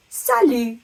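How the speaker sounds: French "salut" with no T at the end; emphatic teacherly "salut", T dropped; silent final T. Shown as a demonstration. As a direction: model "salut" clearly, ending on the vowel. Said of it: "T on the end is completely silent".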